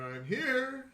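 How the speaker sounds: high, loud announcement; rowdy character calling out; boisterous arrival. Acted. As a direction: call it out as a big entrance. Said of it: "boisterous individual going".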